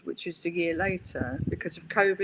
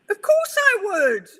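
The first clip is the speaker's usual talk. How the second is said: high, loud and slow, bursting with delight; overjoyed acceptance; 12 semitones higher, 10 dB louder, slower than usual. Retold or imitated. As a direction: stretch it out, thrilled to say yes. Retold eager reply to honour.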